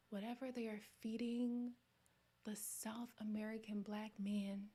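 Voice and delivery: secretive whisper. Real, not acted. Telling viewers a secret about Southern men, a whisper. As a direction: lean in and whisper it.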